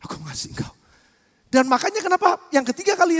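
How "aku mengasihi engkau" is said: whispered, reluctant reply from Peter. Acted. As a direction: barely breathe it out, unwilling. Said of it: "Sambil rada berat meninggalkannya".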